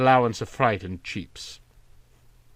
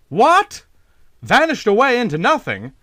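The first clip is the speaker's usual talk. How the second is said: sharp, much higher burst of disbelief; high, eager, louder cry of surprise; excited; 10 semitones higher, 5 dB louder than usual. Acted. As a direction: leap up in startled excitement. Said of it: "asked Van Cheele excitedly".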